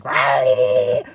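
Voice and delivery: loud, drawn-out animal roar; hammed-up critter screech; mock raccoon snarl. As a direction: imitate an angry raccoon, loudly. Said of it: "this raccoon thing is like".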